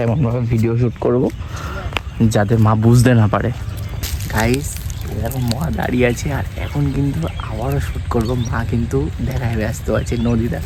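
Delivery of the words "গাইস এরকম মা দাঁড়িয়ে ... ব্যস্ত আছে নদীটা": much softer, hushed aside so mother won't hear; quietly narrating the video behind mother's back; speaking low. Real, not acted. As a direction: keep it down, she's close by. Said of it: "আস্তে আস্তে কথা বলছি".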